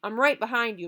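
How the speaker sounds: ordinary delivery, nothing marked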